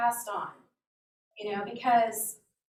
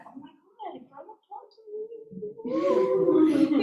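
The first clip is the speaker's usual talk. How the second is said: high, drawn-out squeal breaking into laughter; reliving a kid's thrill, building louder; giddy excitement; 9 semitones higher, 6 dB louder, much slower than usual. Retold or imitated. Child thrilled a grown-up talked to them, giddy excitement. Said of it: "I was so excited".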